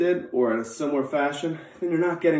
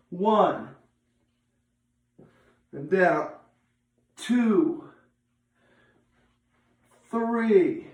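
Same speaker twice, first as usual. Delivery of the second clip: barking gym coach; 4 semitones higher, much slower than usual. Real, not acted. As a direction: call out each rep like a coach. Coach counting sit-up reps.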